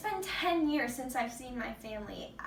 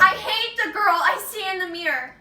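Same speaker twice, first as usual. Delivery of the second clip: loud, higher, self-loathing cry; anguished outburst; 6 semitones higher, 9 dB louder than usual. Acted. Trafficked girl confessing self-hatred, a loud anguished outburst. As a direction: hurl it at your own reflection.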